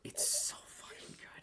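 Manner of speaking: hushed delighted whisper. Real, not acted. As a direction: breathe it out in awe. Whispered aside after the bit.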